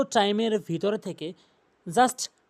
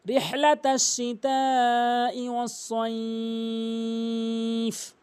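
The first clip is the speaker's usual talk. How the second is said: slow chanted recitation; Quran recitation; 3 semitones higher, much slower than usual. Shown as a demonstration. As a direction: recite slowly, melodic.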